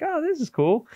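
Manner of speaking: high, cheery dog voice; happy dog voice. Retold or imitated. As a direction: voice the dog as friendly and pleased. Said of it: "be like".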